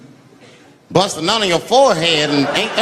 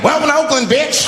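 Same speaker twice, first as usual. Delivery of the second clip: shouted high, rough welcome; hostile greeting; 9 semitones higher, 4 dB louder than usual. Acted. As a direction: yell it like a street welcome. Mock Oakland greeting shouted.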